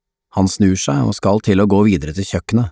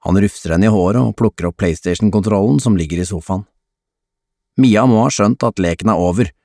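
ordinary delivery

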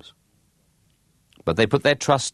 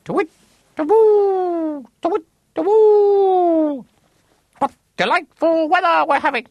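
hooting owls; 7 dB louder than usual. Acted. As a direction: call across the forest like an owl. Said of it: "called out to each other".

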